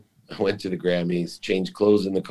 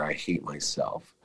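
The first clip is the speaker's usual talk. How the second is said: much softer, slow, drained; quiet final admission; 2 semitones lower, 10 dB quieter, slower than usual. Retold or imitated. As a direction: let it fall away to almost nothing, slowly. Inner realization repeated, fading.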